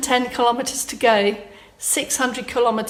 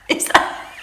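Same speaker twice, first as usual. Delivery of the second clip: giggly; 6 semitones higher, 4 dB louder than usual. Real, not acted. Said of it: "I don't know why I'm laughing".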